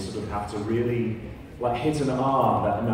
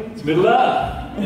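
high, slow, laughing exclamation; real laugh through the words; 12 semitones higher, 5 dB louder, much slower than usual. Real, not acted. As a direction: burst out laughing at the absurdity. Laughing at dwarves sounding Scottish.

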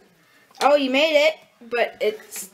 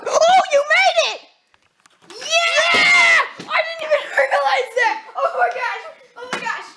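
high laughing shouts of joy; excited shouting; 11 semitones higher, 4 dB louder than usual. Real, not acted. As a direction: cheer loudly through laughter. Flip lands, celebrating.

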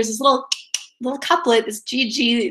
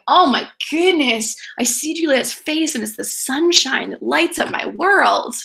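higher-pitched lovestruck gushing; Romeo; 4 semitones higher than usual. Acted. Teacher paraphrases Romeo seeing Juliet, higher, gushing awe. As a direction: swoon over Juliet in plain modern words. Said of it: "Is Romeo going".

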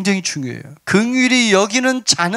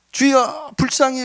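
high, pleading mock call; imitated crying out; 6 semitones higher, slower than usual. Blind men calling to passing Jesus, imitated high cry. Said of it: "막 옆에서 소리를 지르니까".

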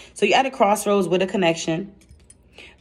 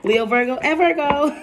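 excited; 7 semitones higher than usual. Real, not acted. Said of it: "I get excited when I do Leo".

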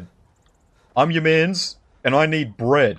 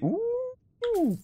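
delighted ooh; 5 dB quieter than usual. Real, not acted. Spots bread in game.

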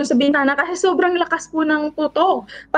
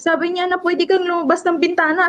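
normal talking voice

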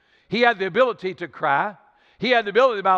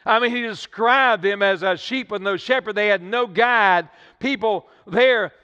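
rising, louder preacher's cadence; full-force preaching; 3 semitones higher, 4 dB louder than usual. Acted. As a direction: drive it home like a revival preacher.

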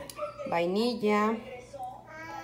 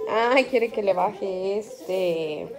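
usual speaking voice

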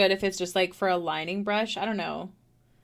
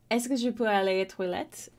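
French in a thick English accent. Shown as a demonstration. Canadian streamer tries school French with an English accent. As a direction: say the French line with an anglophone accent. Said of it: "with a horrible, I'm sure, accent".

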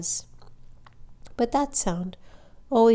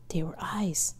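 whispered; 6 dB quieter than usual. A narrator's reading. Tense narration, seeing eyes.

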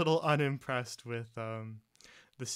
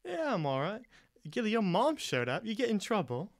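higher teasing voice; Asta teasing; 9 semitones higher, 4 dB louder than usual. Acted. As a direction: needle Gilly about his mom.

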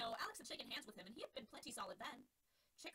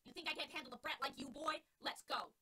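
tough challenge; 4 semitones higher, 5 dB louder than usual. Acted. Catcher squares up to fight.

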